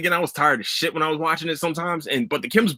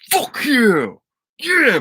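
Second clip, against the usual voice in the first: excited cheer; 10 semitones higher, much slower than usual. Retold or imitated. Hearing the show got renewed, a high excited cheer. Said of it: "I was like".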